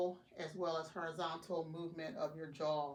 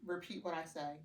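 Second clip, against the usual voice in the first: usual speaking voice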